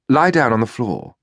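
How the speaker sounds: lightly voiced plummy upper-class English accent; a hint of a posh English officer giving an order. A narrator's reading. Boyd orders Early to the floor, plummy English accent. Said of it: "in that plummy accent of his".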